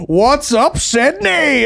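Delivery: high, loud hype-man yell; tour crowd greeting. Pretend. Comic greeting a Sydney tour audience, mock stage shout. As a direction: holler it out to a huge crowd. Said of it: "if I ever do an Australian tour".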